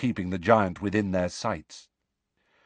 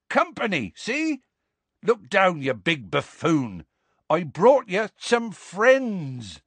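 Hagrid calling up; 8 semitones higher, slower than usual. Acted. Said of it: "Hagrid shouting up at Grawp".

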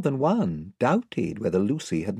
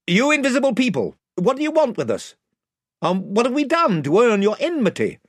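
raised, high formal call; louder voice; 13 semitones higher, 5 dB louder than usual. A narrator's reading. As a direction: call out to an unseen crowd. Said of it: "added in a louder voice".

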